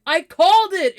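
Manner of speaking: loud, high imitation of a boast; triumphant mock shout. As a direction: crow it out, teasing a friend. Reenacts friend's triumphant chat message.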